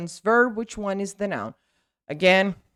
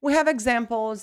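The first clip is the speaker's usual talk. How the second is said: usual speaking voice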